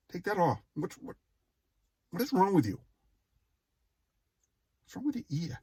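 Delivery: imitating an exasperated dad demanding answers; dad scolding. Dad scolding his son about the earring, imitated exasperated.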